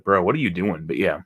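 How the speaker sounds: ordinary delivery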